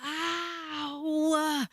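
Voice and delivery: high sharp yelp of pain; pained cry. Retold or imitated. Stuck under the bank counter, a high yelp of pain. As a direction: cry out like something hurt you. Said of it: "and I was like".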